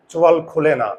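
ordinary delivery

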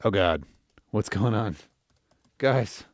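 normal talking voice

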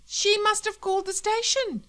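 very high, loud protest; panicked excuse. Acted. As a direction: protest your innocence in alarm. Husband pleads with armed wife.

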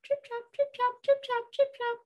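very high, tiny trip-trap; high, light goat hoofbeats; small hooves. Acted. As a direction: patter away in a high little voice. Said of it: "off went the smallest billy goat".

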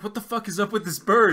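incredulous outburst. Retold or imitated. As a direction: shout it, totally baffled. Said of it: "it's like".

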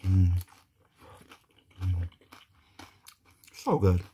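chewing while talking; mumbled through food; mouth full. Real, not acted. Seen 1: Food at lips and chewing after bite at -2; talks mid-chew.

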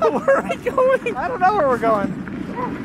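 giddy high laugh into words. Real, not acted. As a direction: laugh helplessly, rolling downhill. Laughing on runaway wagon.

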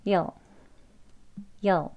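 "여", you'll as a tiny "yuhl"; most reduced form. Shown as a demonstration. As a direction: model "여" very short with a weak vowel. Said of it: "더 간단하게 간소한 발음이죠".

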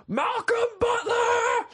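slow high yell across the stadium; drawn-out stadium yell. Retold or imitated. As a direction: bellow the name at the field. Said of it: "I kept yelling".

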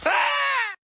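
put-on loud kid shriek; high scream. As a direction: scream when grandma hits you. Hit by grandma.

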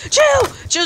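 high frantic shouts of no and chill; panicked yelling. Real, not acted. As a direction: yell in a panic as he's caught.

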